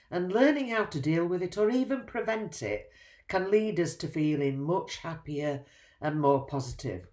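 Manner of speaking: ordinary talk, nothing marked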